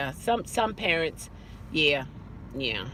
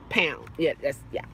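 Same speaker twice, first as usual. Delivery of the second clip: ordinary talk, nothing marked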